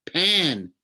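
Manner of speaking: a satyr insulted by the mix-up; indignant. A narrator's reading. Grover correcting Percy, indignant. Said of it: "he said indignantly".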